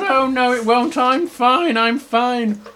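slow, protesting storybook bear; Little Bear. Acted. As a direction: brush off the warning in a bear voice. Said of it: "And Little Bear goes".

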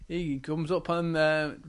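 ordinary delivery

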